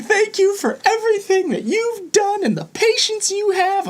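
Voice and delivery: high, laughing mock teary voice; teary mom. Teacher imitating a parent's teary thanks with a laugh. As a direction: ham up a tearful thank-you. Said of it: "her lip quivered".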